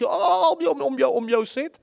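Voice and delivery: an eighteen-year-old still demanding, mock whining; whiny baby. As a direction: whine like a grown baby. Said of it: "je kind met achttien".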